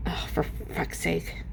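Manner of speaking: muttered whispered curse. Real, not acted. Fighting the phone, a whispered curse. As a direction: breathe it out in frustration. Frustrated at sliding screen.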